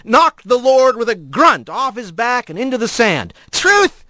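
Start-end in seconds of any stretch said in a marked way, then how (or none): 3.5-4.1 high, breathless gasp of a word; gasped retort. Acted. Tayo, pinned in the sand, gasps in outrage. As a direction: spit it out winded and stunned. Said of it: "he gasped".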